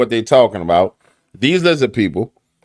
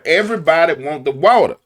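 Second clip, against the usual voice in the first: ordinary delivery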